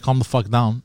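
ordinary delivery

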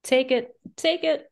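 teasing hand-off; playful insistence. Retold or imitated. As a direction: hand it off eagerly. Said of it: "And I said".

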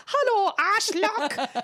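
high, drawn-out German greeting; slow, high-pitched mock German. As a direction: imitate a little boy's cheery German hello. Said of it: "Kai in German goes".

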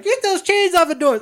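high, loud mock command; shouted order. Principal ordering chains off the doors, shouted mock order. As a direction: yell an urgent order, hammed up.

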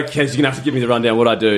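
fast, hurried plea; rushed question. Retold or imitated. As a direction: gabble it out anxiously. Asking friend what happened.

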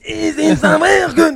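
loud, high, drawn-out caricature; Roland Courbis. Retold or imitated. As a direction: stretch it out in a hammed-up imitation. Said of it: "bifurqué vers du cou-, Roland Courbis". (imitation forcée, aiguë et traînante)

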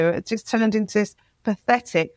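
ordinary delivery

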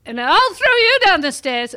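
high, loud mimicked threat; mock furious parental shout; retold yelling. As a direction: act out father yelling at a child. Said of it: "he would yell at me".

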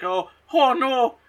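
high, loud, slow mock lament; hammed-up Irish-style wail of grief; Bowler Hat. Fiance finding his lady gone, comically wailing. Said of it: "Bowler Hat's back and he's like".

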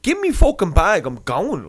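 high, loud angry demand; retold losing it. Passenger demands his bag from airline man, acted outburst. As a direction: act out snapping at airline staff, pitch shooting up. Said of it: "Like, I lost it".

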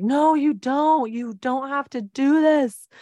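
put-on high, slow, pleading child's voice; ten-year-old begging her dad to stay, high and slowed; childlike pleading. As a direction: beg your dad not to go, voice up high like a kid. Child begging father not to leave.

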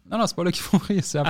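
high-pitched mock objection; retold protest. Retold protest to audience laughing early. (protestation rejouée, voix aiguë)

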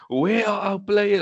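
high, drawn-out exasperated call; mock yell at the players, stretched out; retold shouting. Fan calling out to his team through the TV, retold shout. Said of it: "and I was shouting".

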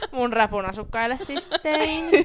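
bursting into a loud laugh, then slow words; giggling through the joke. Real, not acted. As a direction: laugh it out slowly. Joking about her rap residents.

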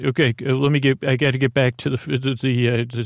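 ordinary talk

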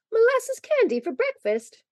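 high, drawn-out amused disbelief; amused cry. A narrator's reading. Polly teases Joey about candy, high and amused. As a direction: ask it high and slow, in amusement. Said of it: "cried Polly laughingly".

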